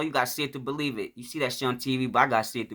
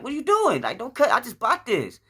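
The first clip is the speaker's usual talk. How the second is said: high-pitched pleading objection, re-enacted; very high retold complaint; alarmed protest; 11 semitones higher than usual. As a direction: protest in mock dismay to save the outfit. Retold protest at clothes being cut.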